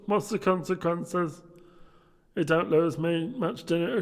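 high, loud put-on young gentleman; merry nephew. Nephew telling guests about his comical uncle, high loud voice. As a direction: pitch it high and project.

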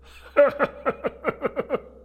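high, loud burst of laughter; hearty acted laugh. Scrooge's nephew laughing at his uncle, high hearty laugh. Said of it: "Laughed Scrooge's nephew".

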